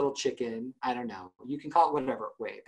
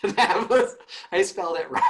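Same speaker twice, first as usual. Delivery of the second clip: higher, louder, through laughter; breaking up laughing; 5 semitones higher, 5 dB louder than usual. Real, not acted. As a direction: laugh while admitting your own blunder. Cracking up at own misspelling.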